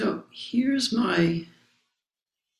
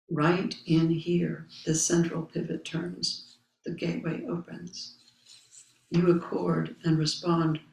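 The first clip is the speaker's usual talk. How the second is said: solemn text recitation. Acted. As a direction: recite each phrase with space around it. Reading the Zen text aloud.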